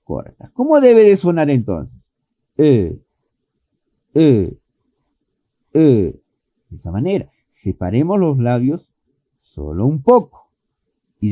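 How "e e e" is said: "E" as a short sound between Spanish e and i, lips barely apart; a short "E" with the jaw just dropping; short lax vowel. Shown as a demonstration. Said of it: "decimos una E corta". (una "E" corta, entre la e y la i española)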